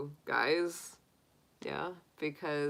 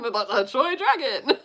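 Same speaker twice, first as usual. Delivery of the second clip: laughing dragon; 7 semitones higher, 5 dB louder than usual. Retold or imitated.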